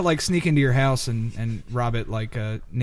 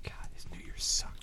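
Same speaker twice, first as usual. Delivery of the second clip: whisper; 16 dB quieter than usual. Real, not acted. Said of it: "Good talk".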